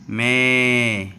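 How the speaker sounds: मैं held very slowly, long e sound; long e vowel. Shown as a demonstration. Teacher stretching मैं to show the long e. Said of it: "ए की आवाज़ आएगी लंबी".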